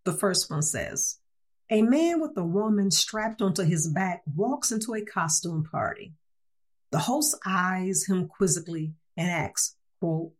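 ordinary talk, nothing marked

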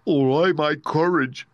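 high, loud, slow lament; Cowardly Lion. Acted. Lion asking about promised courage, loudly plaintive. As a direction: draw it out in a big character voice. Lion's character voice.

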